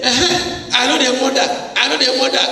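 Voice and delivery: high, fast mock blurt; a pretend sister spilling the secret; hammed-up eager girl, high and fast. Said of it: "Did she say".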